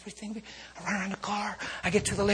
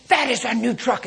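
insistent lady; 7 semitones higher, 9 dB louder than usual. Retold or imitated.

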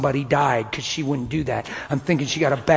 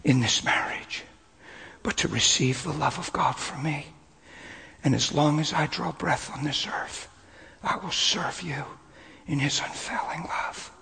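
soft, slow, hushed promise; husband vowing softly to wife; tender vow; 6 dB quieter than usual. Retold or imitated. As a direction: vow it gently, fading to a whisper. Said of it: "I said".

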